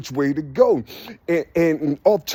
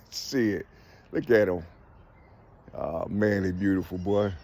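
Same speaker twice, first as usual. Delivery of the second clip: soft admiring aside; 10 semitones lower, 7 dB quieter than usual. Real, not acted. Admiring the birds.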